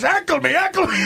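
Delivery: put-on high, eager foreign-man accent; high and pleading in a foreign-man accent; Milos's accent. Director Milos urging the actor to heckle him, in a high accent. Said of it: "And he goes".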